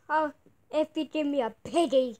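ordinary talk

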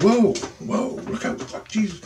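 dodging something that rushes at you; startled alarm. Pretend. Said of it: "You're like".

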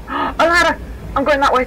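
loud, high burst of excitement; excited shout. Real, not acted. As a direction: yell out the discovery, pitch jumping up. Spots a ladder as escape.

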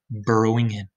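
ordinary delivery, nothing marked

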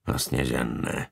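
low, slow, quiet growl; grudging growl under the breath. A narrator's reading. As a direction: grumble it low and slowly. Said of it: "zavrčel".

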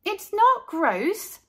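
high, slow, stress on not; high protest leaning on not; indignant girl. A narrator's reading. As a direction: defend her sandwich. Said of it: "said Carla".

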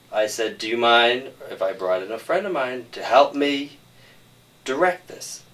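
ordinary delivery

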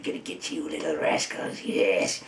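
cackling villain, low and hushed; sinister little cackle and quiet menace; Joker. Acted. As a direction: gloat softly like a wicked cartoon villain. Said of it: "Mark Hamill doing his Joker face".

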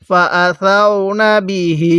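measured chant with the last "hee" of বিহি held; slow Quran recitation. Shown as a demonstration. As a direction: recite slowly, stretching the end of বিহি. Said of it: "কিভাবে পড়ব?".

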